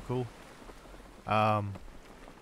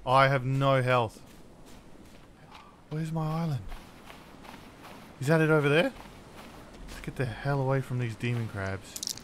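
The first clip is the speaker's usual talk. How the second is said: ordinary delivery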